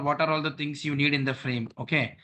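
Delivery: usual speaking voice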